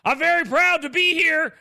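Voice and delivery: loud, high hammed-up wrestling announcer; wrestler promo voice. Wrestler pandering to a local crowd, hammed-up and loud. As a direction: ham it up like a wrestler working the crowd. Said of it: "that professional wrestling thing".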